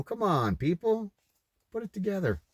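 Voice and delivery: high, pleading come on; exasperated plea. Real, not acted. Vlogger pleading with viewers to connect dots, exasperated. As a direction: beg the audience to see it.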